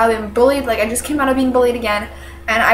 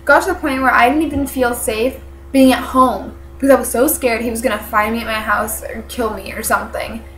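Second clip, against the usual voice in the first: usual speaking voice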